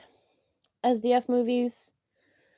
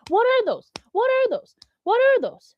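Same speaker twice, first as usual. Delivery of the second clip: meme catchphrase; 14 semitones higher, 3 dB louder than usual. Acted.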